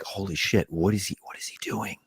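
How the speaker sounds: alarmed aside under the breath; hushed disbelief. Retold or imitated. Crew watching someone tease the star, hushed disbelief.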